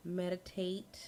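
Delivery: soft, low-volume murmur; very quiet. Real, not acted. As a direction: talk softly as if far from the mic.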